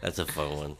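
ordinary talk, nothing marked

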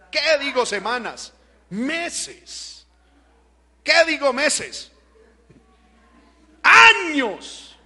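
escalating preacher's outburst; mounting exclamation. Real, not acted. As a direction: build in steps to a loud, drawn-out cry. Escalating rhetorical correction to years.

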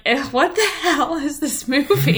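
mock disgust with a laugh in it; a retold, laughing put-down of a movie; laughing scoff. Mocking a bad movie with ex.

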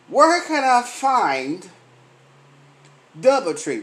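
drawn out, slow and puzzled; a clueless tourist asking directions, slowly; slow lost tourist voice. Retold or imitated. Mimicking a lost tourist asking the way, slow and puzzled.

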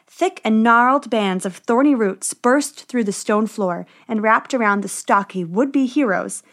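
ordinary delivery, nothing marked

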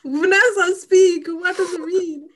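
high, loud demand to speak up; excited call-out. Real, not acted. Friend loudly urging Vanessa to explain the word, high and loud.

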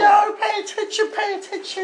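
high, insistent put-on mum voice; scolding mum. Dave's mum correcting the confused host, high scolding voice. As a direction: tick him off in a high-pitched mother voice. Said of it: "It's Dave's mum".

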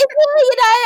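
very high, loud imitation of brother. Brother insisting to his sister, high-pitched mimicry. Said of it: "High voice".

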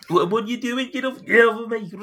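high, loud Geordie gnome protesting; panicked gnome. Acted. GM voicing the wriggling gnome Geordie, high panicked squeal. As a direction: squeal at him to let go. Gnome struggling against Krill's grip.

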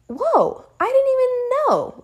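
high, surprised exclamation; surprised whoa. Retold or imitated. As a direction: sound amazed it's already over. First tooth already out, surprised.

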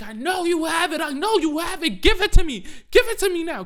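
high, loud screamed demand, imitating a kid; losing it. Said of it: "And Sugar loses it".

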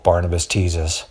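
plain reading voice

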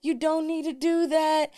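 high, slow pleading voice; friends pleading. Retold or imitated. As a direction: imitate the friends begging her to stop. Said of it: "my sister and my best friend was like".

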